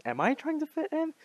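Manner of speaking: high-pitched doubting question on "Am I trying to fit in"; self-questioning. Real, not acted. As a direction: ask yourself "Am I trying to fit in", voice shooting up. Turns the question on herself.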